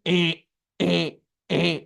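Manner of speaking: imitated buzzer, short repeated bleats; machine alarm beep. Speaker imitating the scanner across the security queue, alarm beeps. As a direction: mimic an alarm going off.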